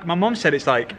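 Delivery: ordinary talk, nothing marked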